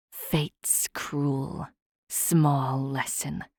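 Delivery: slow whispered sneer; hiss-free slow whisper, bitter. A narrator's reading.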